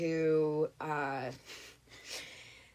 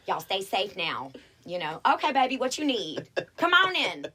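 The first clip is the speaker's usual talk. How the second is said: Southern hospitality voice; 2 semitones higher, 5 dB louder than usual. Shown as a demonstration. At the door greeting a guest, syrupy Southern welcome. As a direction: welcome them in like a sweet Southern hostess. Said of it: "I think I wrangle it pretty well".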